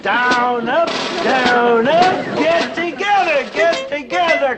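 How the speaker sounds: barking out exercise counts like a drill sergeant; drill sergeant calls. Acted. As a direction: drill them hard through the reps. Father drilling boys in exercises.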